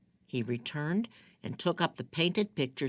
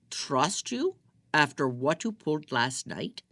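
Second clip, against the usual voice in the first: scornful disbelief; 3 semitones higher, 4 dB louder than usual. Acted. Ahmed scornful reply.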